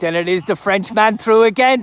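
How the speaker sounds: loud, high rising excitement; excited shout. Real, not acted. Commentator seeing the Frenchman break through, shouting excitedly. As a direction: call the break with a surge of energy.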